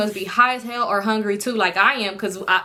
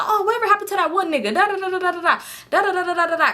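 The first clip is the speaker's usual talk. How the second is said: rambling high chatter; 8 semitones higher, faster than usual. Retold or imitated. Mimicking their rambling catch-up.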